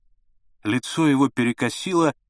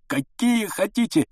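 pleading cry; 9 semitones higher than usual. A narrator's reading. Repeated plea rising.

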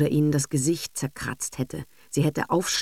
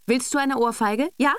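furious shout; 8 semitones higher than usual. A narrator's reading. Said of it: "laut herausgeschrien".

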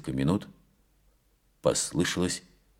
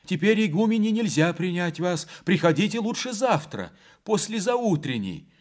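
much higher, unsteady frightened nun; faltering voice; 12 semitones higher than usual. A narrator's reading. Frightened gatekeeper nun refusing the riders in a high faltering voice. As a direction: stammer it out through the gate, scared. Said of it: "сказала она неверным языком".

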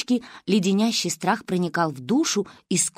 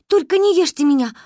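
frightened mumble; 8 semitones higher, 6 dB louder than usual. A narrator's reading. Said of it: "промямлила еле слышно".

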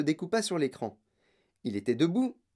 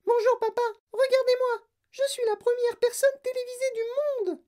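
put-on extremely high miniature boy; very high, tiny piping voice; tiny mouse-squeak voice. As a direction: squeak it out like someone an inch tall. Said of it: "Une toute petite voix".

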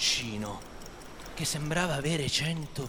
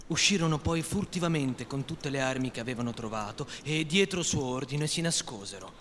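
ordinary delivery

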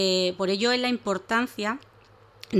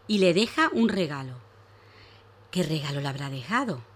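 story narration; 3 dB louder than usual. Acted. Reads a story to children.

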